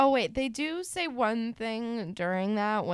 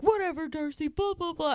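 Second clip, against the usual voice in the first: higher, mocking brush-off with sing-song blahs; mocking dismissal; 8 semitones higher than usual. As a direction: wave her off scornfully. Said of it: "he's like".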